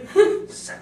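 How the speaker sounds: giggle. Real, not acted. Giggle mid-sentence.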